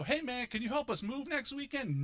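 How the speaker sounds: lightly high friendly request; a hint of a pal asking a favour; mock buddy ask. Friends asking for help moving, lightly high voice. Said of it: "That same group of friends can come to me and go".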